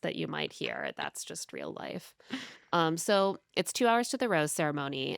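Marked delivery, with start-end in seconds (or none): 0.9-2.1 soft, slow whisper under the breath; whispered aside. Real, not acted. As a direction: whisper it like a private joke. Wry aside about kids' noise.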